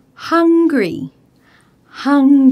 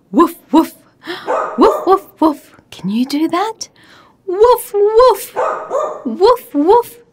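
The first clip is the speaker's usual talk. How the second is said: dog bark imitation; 5 semitones higher than usual. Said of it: "It sounds like a".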